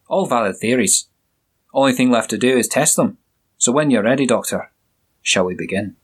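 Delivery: a hint of a jovial Scottish burr; Scottish accent. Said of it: "with a strong Scottish accent".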